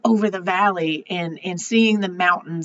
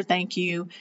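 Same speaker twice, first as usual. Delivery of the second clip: barely getting the words out, softer; holding back tears, a fragile quiet thank you; choked-up thanks; 3 semitones lower, 8 dB quieter than usual. Retold or imitated. Leaving the bar, choked up, thanking the bartender quietly.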